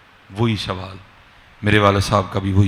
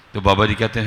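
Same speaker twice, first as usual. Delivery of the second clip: ordinary talk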